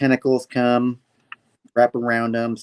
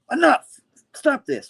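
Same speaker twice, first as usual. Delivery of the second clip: high-pitched mock angry outburst; ticked off; 4 dB louder than usual. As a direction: act out her exasperated command. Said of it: "she gets all ticked off".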